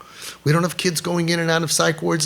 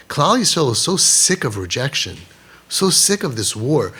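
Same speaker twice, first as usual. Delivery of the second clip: ordinary delivery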